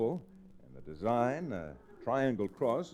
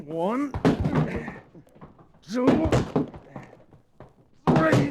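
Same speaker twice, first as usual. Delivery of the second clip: loud countdown; 8 dB louder, much slower than usual. Acted. Gunman counting down to the draw, shouting louder each number.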